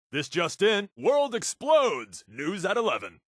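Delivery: lightly voiced TV anchor, mock grave; mock news anchor. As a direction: announce the end of the world like breaking news.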